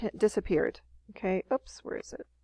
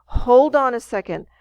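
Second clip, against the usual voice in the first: acted exclamation; 4 semitones higher, 8 dB louder than usual. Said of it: "He's like".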